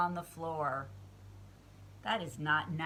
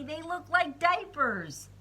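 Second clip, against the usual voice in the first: higher, louder whiny complaint; playful whine; whining; 8 semitones higher, 6 dB louder than usual. Acted. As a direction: complain like a pouty child. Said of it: "whined Froggy".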